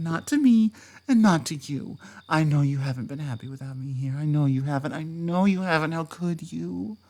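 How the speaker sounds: usual speaking voice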